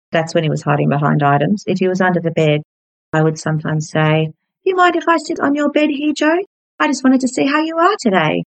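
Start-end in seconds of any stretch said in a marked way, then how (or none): 4.6-8.4 high, gentle, innocent voice. Retold or imitated. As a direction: daughter gently asking her frightened father how he is. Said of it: "we'd just talk very innocently".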